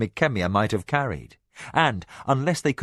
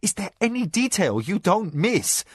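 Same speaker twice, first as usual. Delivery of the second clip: in wonder; 8 semitones higher than usual. A narrator's reading. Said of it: "said in wonder".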